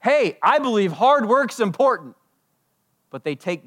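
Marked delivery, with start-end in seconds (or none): 0.0-2.2 mock claim. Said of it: "if someone told you".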